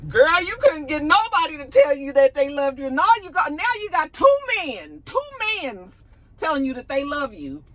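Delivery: high, loud mock astonishment; incredulous reaction. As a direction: exclaim to a girlfriend in hyped-up disbelief. Acted astonished reaction to Issa.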